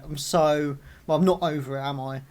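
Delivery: ordinary delivery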